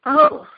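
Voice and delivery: high, loud pained gasp; Diggory's hurt gasp. Acted. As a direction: gasp as if struck. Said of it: "gasped Diggory, as if he had been hurt".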